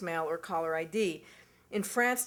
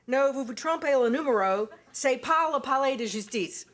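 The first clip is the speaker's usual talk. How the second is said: a higher, louder, anglicized French with hard American vowels; clumsy American French; 5 semitones higher, 4 dB louder than usual. Retold or imitated. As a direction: bluff through the French the way an American learner would. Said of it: "it came off sounding something like".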